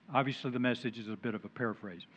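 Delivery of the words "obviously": usual speaking voice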